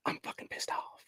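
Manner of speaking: whispered through barely held rage; a quiet, furious whisper; seething whisper. Real, not acted. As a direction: hiss it out under your breath. Venting anger, sudden drop to whisper.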